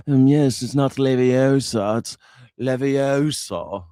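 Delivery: mock Hermione correction, slow drawn-out Leviosa; a lightly voiced prim British schoolgirl; mock posh British. Streamer mocking Harry Potter English accents. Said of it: "These Harry Potter talking motherfuckers".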